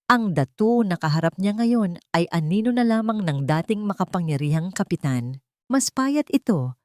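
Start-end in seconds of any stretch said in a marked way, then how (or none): none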